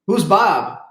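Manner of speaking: high, loud husband's outburst; startled punchline. Acted. Husband to wife on seeing her tattoo, startled.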